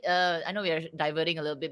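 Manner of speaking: usual speaking voice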